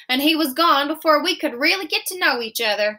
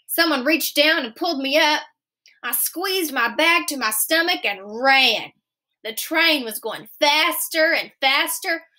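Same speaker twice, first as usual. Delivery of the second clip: plain reading voice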